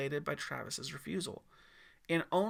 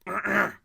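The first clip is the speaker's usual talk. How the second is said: a sharp clearing of the throat; nervous throat clear; 5 semitones higher, 8 dB louder than usual. Acted. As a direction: clear the throat before speaking. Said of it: "He cleared his throat".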